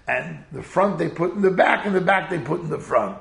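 usual speaking voice

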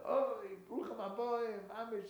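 high, drawn-out, gushing greeting; effusive welcome. Acted. Innkeeper greets his Rebbe arriving at the inn, effusive. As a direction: hail an honoured guest with great fanfare.

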